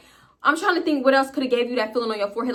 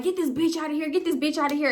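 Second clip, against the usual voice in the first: mock dudes' jeering; 6 semitones higher, faster than usual. Storyteller imitates dudes demanding she be thrown out, jeering. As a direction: lightly mimic the dudes wanting her gone.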